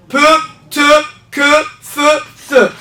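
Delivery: slow, separate sounds with pauses; repeat-after-me drill. Shown as a demonstration. Teacher asking learners to repeat with her, slow syllable-by-syllable drill. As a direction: give each sound its own beat. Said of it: "عاود معايا".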